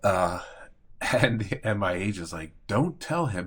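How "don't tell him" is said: higher, emphatic retold order; imitating insistent agent. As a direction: forbid it firmly, mimicking someone pushy.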